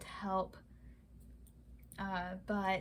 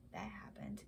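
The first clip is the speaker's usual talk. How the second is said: breathy sheepish whisper to herself; self-conscious whispered afterthought; whispered aside; 12 dB quieter than usual. Real, not acted. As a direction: whisper it under your breath, a little embarrassed. Awkward aside after a pause.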